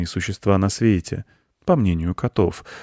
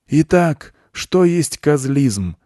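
slow, raised, ceremonious opening; solemn; 8 semitones higher, 5 dB louder, much slower than usual. A narrator's reading. Goat begins his teaching to the children, solemnly. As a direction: open the lecture with great solemnity. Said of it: "торжественно начал Серый Козёл".

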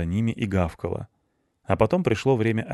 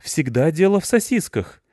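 indignant; 9 semitones higher, 5 dB louder than usual. A narrator's reading. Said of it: "возмутился Рыжопиус".

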